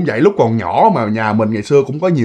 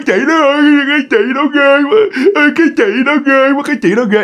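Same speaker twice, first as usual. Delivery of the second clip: put-on high, tearful small-child whine; imitated child repeating a frightened cry; crying toddler; 10 semitones higher, faster than usual. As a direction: mimic a scared toddler wailing. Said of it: "Mà nó cứ".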